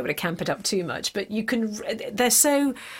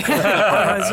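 laughing; 6 dB louder than usual. Real, not acted. Laughing while answering.

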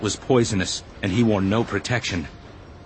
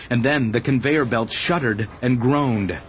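usual speaking voice

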